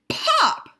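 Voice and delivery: high, loud wailing pop; frustrated squeal. Acted. Skunk wailing that it should pop, high and loud. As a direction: let it burst out in frustration.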